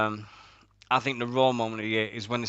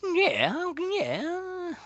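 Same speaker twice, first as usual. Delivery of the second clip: high squeal. Real, not acted. Elated agreement, high squeal.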